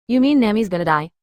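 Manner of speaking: usual speaking voice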